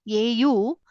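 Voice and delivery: usual speaking voice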